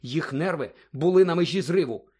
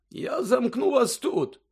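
slow, higher-pitched headmaster voice; deliberate words from an old wizard; 10 semitones higher, slower than usual. Acted. Dumbledore speaking. (повільний голос старого директора)

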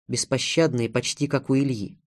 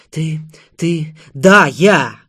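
sudden, very loud outburst; full-voiced cry of admission; shouting; 3 semitones higher, 10 dB louder, much slower than usual. A narrator's reading. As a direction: burst out with a defiant shout. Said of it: "Она кричала".

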